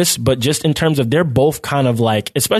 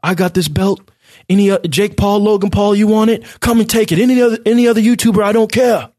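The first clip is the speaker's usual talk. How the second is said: high, loud brash challenge; cocky boxer callout; 10 semitones higher, 3 dB louder than usual. Retold or imitated. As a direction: shout it like a cocky champ. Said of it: "He was like".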